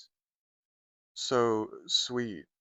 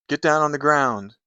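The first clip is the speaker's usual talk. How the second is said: loud, higher robber's command; thief's shouted order; 5 semitones higher, 12 dB louder than usual. Acted. Thief orders the narrator down in a bank, shouted command. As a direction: bark it like a bank robber taking charge.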